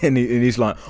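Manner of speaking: normal talking voice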